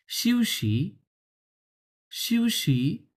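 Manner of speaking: "শিউশি" with light air on each sh. Shown as a demonstration. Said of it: "হালকা পরিমাণ". (হালকা বাতাসে "শিউশি")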